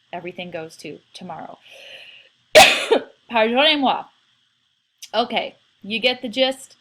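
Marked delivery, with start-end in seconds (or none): 2.5-3.1 loud sharp cough; a real sharp cough mid-lesson; sudden cough. Real, not acted.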